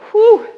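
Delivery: high, loud whew of effort; exertion whew. Real, not acted. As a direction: blow out hard from effort. Exertion during set.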